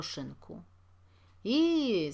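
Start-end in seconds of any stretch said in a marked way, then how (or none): 1.4-2.1 a loud, high hail; the caretaker calls out, higher and louder. A narrator's reading. Caretaker hails Jakub on the street, loud call. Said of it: "zawołał stróż".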